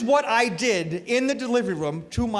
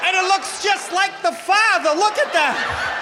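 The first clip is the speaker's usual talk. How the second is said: nurse's voice; 9 semitones higher than usual. Retold or imitated. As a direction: announce the baby like a gushing nurse. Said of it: "She says".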